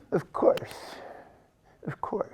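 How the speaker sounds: whispered, airy God voice; a lightly voiced breathy Truman Capote. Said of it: "sounds like Truman Capote".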